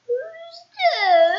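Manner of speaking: high, squeaky, sad little voice. Acted. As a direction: squeak it out like a gloomy little man. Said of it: "a sad, squeaky sort of a voice".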